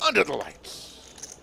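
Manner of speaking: slow, drawn-out announcer call. Acted. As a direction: boom it out like a ring announcer. Dramatic tagline before hushed echo.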